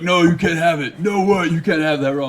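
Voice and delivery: loud high refusal; agent shouting no. Retold or imitated. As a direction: bark the refusal like a pushy agent. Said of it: "And they're like".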